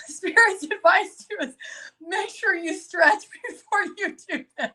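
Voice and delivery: high voice, fighting back real giggles; words bubbling over with laughter; laughing hard. Real, not acted. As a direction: try to give advice while cracking up. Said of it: "I'm laughing so hard".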